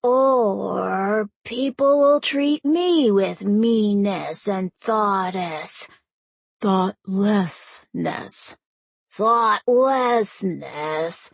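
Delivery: slow, dragged-out sulky drone; slow whiny rote repeat; bored recitation. Acted. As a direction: drag it out like a bored kid. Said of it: "with a theatrical sigh".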